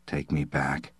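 low, very quiet weary request; dejected. A narrator's reading. Slade asking the operator to leave, dejected. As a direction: ask softly, with the spirit gone.

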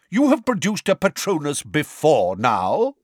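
high, booming inquiry. A narrator's reading. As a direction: boom it at the witness. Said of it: "boomed Madam Bones".

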